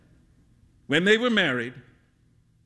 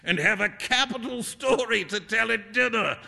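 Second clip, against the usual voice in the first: higher, lively teasing voice; Alan, amused teasing friend; 7 semitones higher than usual. Acted. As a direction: tease your friend in a pitched-up, animated voice.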